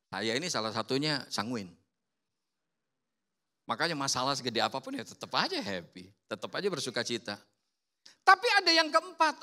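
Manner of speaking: ordinary talk, nothing marked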